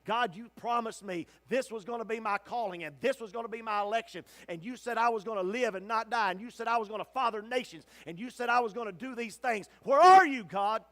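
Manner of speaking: rising to an anguished shout; desperate cry. Acted. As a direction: cry out to God, pleading then yelling. Ishmael's desperate cry to God.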